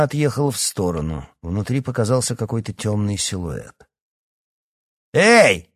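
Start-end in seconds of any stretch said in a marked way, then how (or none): 5.1-5.7 high, loud furious yell; enraged shout. A narrator's reading. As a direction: holler angrily out of the window. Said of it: "разъярённо крикнул Эдуард".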